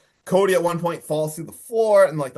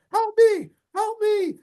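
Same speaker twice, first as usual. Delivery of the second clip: very high mock damsel-in-distress voice; high put-on pleading voice; imitated cry for help; slower than usual. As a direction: squeal it out as a far-off plea. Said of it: "you hear a voice".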